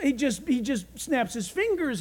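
usual speaking voice

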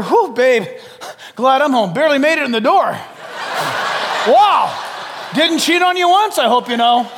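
loud, hammed-up husband boasting to his wife; mock husband bragging. Husband bragging to wife he didn't cheat, hammed-up and loud.

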